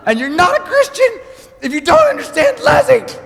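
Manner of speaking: high, loud, laughing preacher rant; laughing mock preaching. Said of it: "I'm going crazy".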